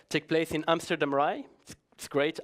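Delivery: ordinary delivery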